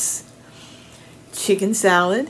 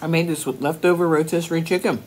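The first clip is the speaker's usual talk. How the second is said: speaking between bites; chewing while talking; 4 dB quieter, faster than usual. Real, not acted. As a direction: mouth partly full of food. Seen 0: Chews before, during and after the words while holding the wrap.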